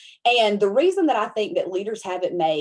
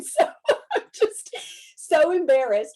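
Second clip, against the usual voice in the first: high squealing laugh through the words; embarrassed laugh; 8 semitones higher, 6 dB louder, slower than usual. Real, not acted. Retelling a card decline to the stream, squealing with laughter.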